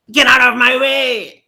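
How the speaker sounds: high, loud shout to clear the road; angry cyclist. A narrator's reading. Man on a bike shouting at the dog, high yell. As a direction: bark it from a moving bike. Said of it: "cried a man on a bike".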